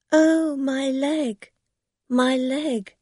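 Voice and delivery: high, slow mock wail; mock cry of pain. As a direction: fake a cry over a leg. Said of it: "she's going to cry out".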